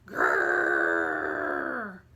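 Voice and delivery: deep loud growl; bear growl. Acted. Bear jumping out to scare the old woman, deep growl. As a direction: growl low from the chest.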